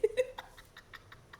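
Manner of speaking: high unvoiced giggle; high breathy laugh. Real, not acted. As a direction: let out a squeaky breathy laugh. Laughs at own stumble.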